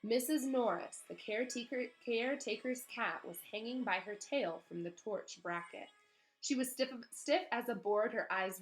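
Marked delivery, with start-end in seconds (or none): none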